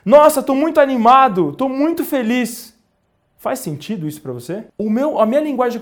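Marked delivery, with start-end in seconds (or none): 0.0-2.7 higher, louder mock enthusiasm; demonstrative show of happy excitement; acted excitement. Shown as a demonstration. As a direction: put on being thrilled, pitched up high. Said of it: "tô falando assim pra vocês".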